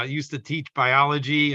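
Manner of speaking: normal talking voice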